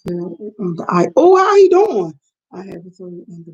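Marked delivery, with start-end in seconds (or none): 1.1-2.1 higher and louder, sudden worry; hearing a viewer's brother fell on the ice, surprised concern. Real, not acted. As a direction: jump up in alarm at the news. Hearing a friend's brother was hurt.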